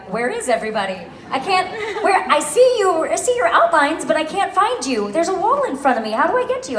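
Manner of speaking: high-pitched mock helpless panic; flustered lost player. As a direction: whine it like a hopelessly lost gamer. Acting out being lost in game.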